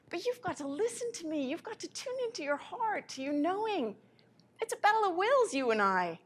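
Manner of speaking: God insisting you listen, rising; God pleading. Pretend. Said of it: "God is saying to you".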